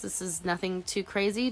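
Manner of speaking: usual speaking voice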